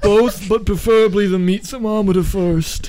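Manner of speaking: high, strange voice with a laugh in it; Leland's odd voice. Acted. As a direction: squeak it out like a man aging backwards. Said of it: "what's happening to Leland's voice?".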